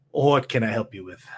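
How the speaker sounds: a hint of a grim undertaker; low mortician voice.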